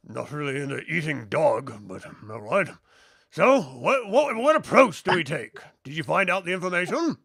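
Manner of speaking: character voice with a swinging tune; inquiring shipmate. Acted. Shipmate asks crew for the approach, expectant. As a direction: question the crew in a lilting put-on voice.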